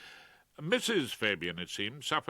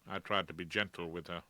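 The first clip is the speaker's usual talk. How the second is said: plain reading voice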